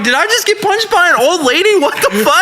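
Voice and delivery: high-pitched retold astonishment; amused disbelief. Bouncer just punched by an old lady, amused disbelief. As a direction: exclaim it as if you can't believe it happened.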